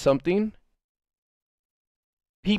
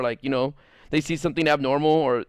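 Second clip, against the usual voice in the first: usual speaking voice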